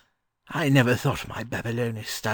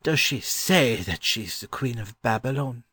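higher gentleman's voice, a touch uneasy; slightly uneasy scholar; uneasy question; 7 semitones higher than usual. A narrator's reading. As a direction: ask with mild unease as a scholar. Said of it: "he uneasily asked".

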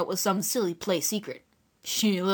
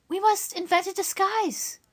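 put-on high young girl, eager to help; a kind girl reassuring the guest; girl's voice; 12 semitones higher than usual. Dora reassuring the stranger, high and eager. Dora's voice.

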